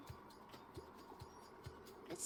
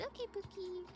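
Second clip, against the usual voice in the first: much higher, sweetly imitating friend; cutesy quote; 8 semitones higher, 4 dB quieter than usual. Retelling a friend's reply, cutesy imitation. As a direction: coo the pet name, light mock. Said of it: "And she said".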